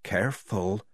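Polly quietly warning Tonka; hushed warning. A narrator's reading. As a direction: warn her under your breath. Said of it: "just loud enough for Tonka to hear".

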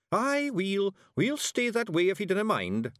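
high Scottish pixie voice; a wee Scots warrior; Scots Feegle. A narrator's reading. Rob Anybody to Miss Level, in a high Scots voice. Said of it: "said Rob Anybody".

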